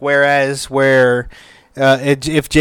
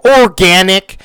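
"Organic" called out higher and louder, like a swear; mock exclamation; 6 semitones higher, 7 dB louder than usual. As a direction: shout "Organic" as a cleaned-up curse. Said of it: "I go".

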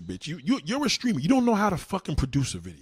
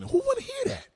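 mocking her voice. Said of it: "And your voice is fucking".

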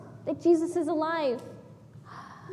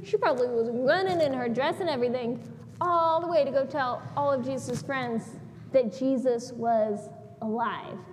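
ordinary delivery

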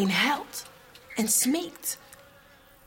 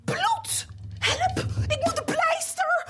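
much higher, frantic cry of panic; panicked shriek. Acted. The king in his bath panics at the blood, high shriek. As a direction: shriek in fright at the bloody water.

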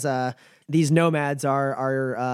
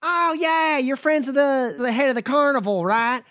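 higher, folksy drawling caravan hand; a wary but friendly trail boss sizing folks up; nomad Bumper; 10 semitones higher than usual. Acted. GM voices Bumper again.

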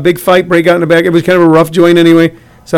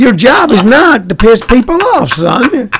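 exaggerated Southern accent, pitched up; Southern booker; 4 semitones higher than usual. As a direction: lecture a young comic as a Southern booker. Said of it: "he said it in a Southern accent".

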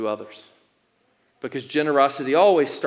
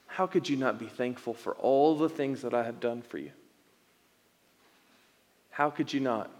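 soft, tender questioning; softer, patient, loving rebuke; gentle voice of God; 5 dB quieter than usual. Retold or imitated. God speaking to Nick in the basement, soft and tender. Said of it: "what I felt like the Lord told me".

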